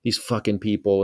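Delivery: usual speaking voice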